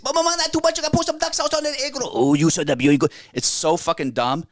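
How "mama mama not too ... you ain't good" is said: high, hammed-up Chinese accent; exaggerated stage Chinese accent; mock Chinese accent. Shown as a demonstration. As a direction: rattle out a caricatured Chinese accent, pitched way up.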